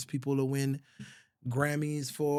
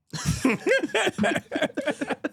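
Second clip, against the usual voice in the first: laugh; 13 semitones higher, 5 dB louder than usual. Real, not acted. Laughs at own remark.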